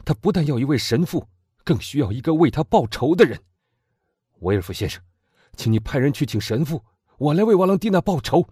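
put-on loud, high, fervent young man; impassioned young man. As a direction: demand revenge with burning passion. Morrel demands justice. (激昂的青年)

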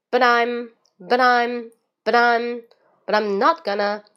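"but I'm" run together as "budaim", firmly; linked "but I'm". Shown as a demonstration. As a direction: link BUT I'M into NOT GONNA with a D for the T. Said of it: "先把这些连读看一下". (but I'm 连读成 budaim)

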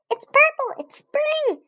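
very high, loud hamster squeaks; squeaking. A narrator's reading. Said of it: "I squeaked".

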